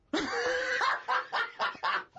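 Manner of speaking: long high laugh; burst of laughter. Real, not acted. Laughing at the girl asking for Martin Wells, long high laugh. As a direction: laugh hard at the punchline.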